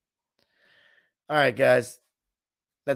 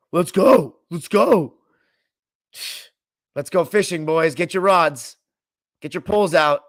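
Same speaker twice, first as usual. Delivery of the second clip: loud, higher coach barking from the sideline; Doc Rivers impression; 3 semitones higher, 6 dB louder than usual. Coach rallying his players during a timeout, loud mock Doc Rivers. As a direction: hype the team up like a fired-up coach. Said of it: "That Doc Rivers impression was phenomenal".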